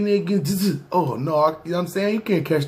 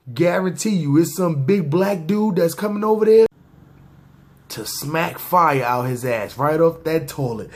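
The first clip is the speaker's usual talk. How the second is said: ordinary talk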